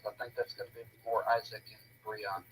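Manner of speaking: normal talking voice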